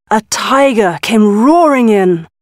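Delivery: ordinary delivery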